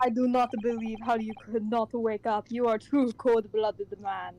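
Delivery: put-on Russian accent, word by word; hammed-up Russian-accented accusation. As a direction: play it with a heavy Russian accent. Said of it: "Russian accent".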